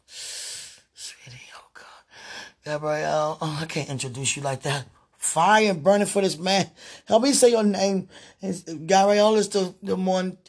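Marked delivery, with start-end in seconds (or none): 0.1-1.8 hushed unvoiced whisper; sudden whisper. Real, not acted. Whispering to her sweetie while stuck. 5.2-10.4 fervent plea. Real, not acted. Pleading loudly for help.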